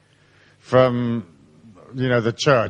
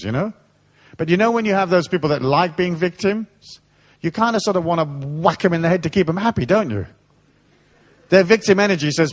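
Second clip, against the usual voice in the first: ordinary talk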